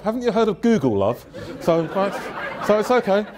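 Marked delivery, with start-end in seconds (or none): none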